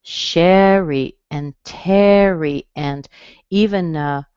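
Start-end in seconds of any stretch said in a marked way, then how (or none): 0.0-2.7 "Sherry and Terry" slow, strong first syllables; slow model pair; strong-weak names. Shown as a demonstration. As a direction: stretch out each name. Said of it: "names like this".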